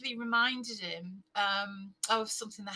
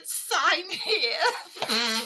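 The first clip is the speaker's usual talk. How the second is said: cheeky demand; 5 semitones higher, 5 dB louder than usual. Retold or imitated. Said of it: "stuck his backside out and said".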